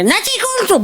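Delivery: put-on high-pitched kid calling to grandma; child's whine. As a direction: whine it like a kid stuck with a tin.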